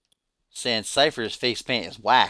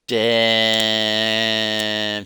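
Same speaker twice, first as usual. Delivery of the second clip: one long, held, level "damn"; drawn-out reaction; 4 dB louder than usual. Real, not acted. Streamer reacting to a brewing roast, long drawn-out damn. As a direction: stretch the word right out.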